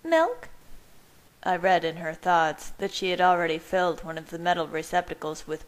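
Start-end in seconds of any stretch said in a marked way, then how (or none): 0.0-0.5 very high, incredulous question; one mocking word, pitched way up; sarcastic. A narrator's reading. Rosalie scoffs at his ignorance, high and sarcastic. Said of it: "she thought sarcastically".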